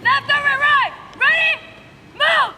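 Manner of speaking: drill sergeant. Real, not acted. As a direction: call cadence like a drill instructor. Drill instructors calling commands.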